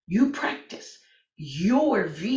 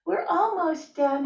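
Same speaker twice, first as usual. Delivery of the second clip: cat's voice; 10 semitones higher than usual. Pretend.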